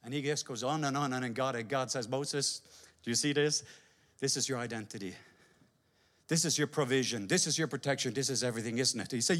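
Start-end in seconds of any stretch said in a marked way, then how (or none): 2.1-9.1 lower, softer, patient voice; gentle God. Acted. God reasoning gently with Moses, lower and softer.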